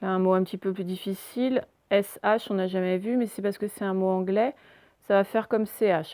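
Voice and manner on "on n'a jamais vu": ordinary talk, nothing marked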